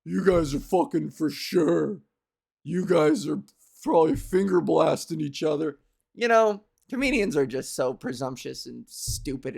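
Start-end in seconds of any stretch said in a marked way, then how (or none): 0.0-5.8 very slow, drawn-out, leering comic; a sleazy club comic needling a couple; smug comedian. Retold or imitated. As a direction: stretch it out like a hack teasing the crowd. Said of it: "the comedian will always be like".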